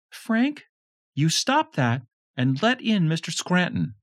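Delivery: high, sharp scolding wife; stern mother voice. A narrator's reading. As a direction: scold him from the next room.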